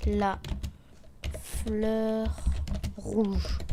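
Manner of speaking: word by word with long pauses; drawn-out dictation. Real, not acted. Streamer typing in the game's name, slow and broken up. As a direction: spell it out slowly as if typing.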